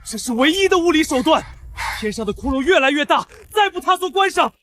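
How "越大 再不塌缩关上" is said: desperate shout. Acted. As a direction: shout it against the end of the world. Hole in sky keeps growing. (绝望地喊)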